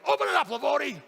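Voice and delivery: loud, high imitation of an impatient boss; foreman's shout. Foreman ordering worker to open envelope, shouted. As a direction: bellow the order.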